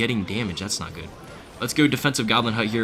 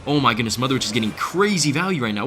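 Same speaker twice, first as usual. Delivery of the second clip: ordinary talk, nothing marked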